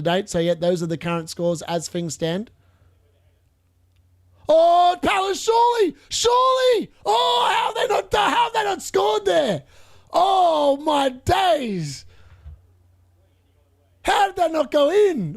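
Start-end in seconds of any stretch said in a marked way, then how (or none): 4.5-9.6 shouting at the screen as the chance goes begging; real disbelieving shout. Real, not acted. Viewer shouting at Palace's missed chance, high disbelieving shout. 10.1-15.4 exasperated disbelief. Real, not acted. Still reacting to miss.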